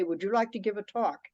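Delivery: ordinary delivery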